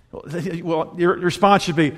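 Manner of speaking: ordinary talk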